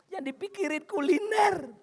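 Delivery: high-pitched complaint; mock exasperation. Husband grumbling at wife's food wish, mock exasperation.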